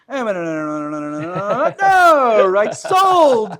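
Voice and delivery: rapid auctioneer chant rising to a loud high "sold". Shown as a demonstration. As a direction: rattle it off like a livestock auctioneer. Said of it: "I sound like an auctioneer".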